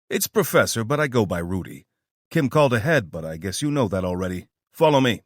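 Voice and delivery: lightly voiced New York accent, brisk; New Yorker accent. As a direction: drop the act, talk like a New Yorker. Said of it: "the accent of a New Yorker sounding far more natural".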